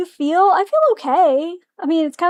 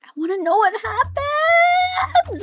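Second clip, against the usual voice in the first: high, slow whining plea, much higher and almost crying; desperate cry; 12 semitones higher, slower than usual. Real, not acted. Desperate to keep playing.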